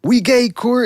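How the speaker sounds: high mock shout of Core. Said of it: "He's like".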